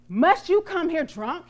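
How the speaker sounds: sharp rebuke in a read-aloud story; stern demand. A narrator's reading. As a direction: demand it like an offended priest. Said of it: "he demanded".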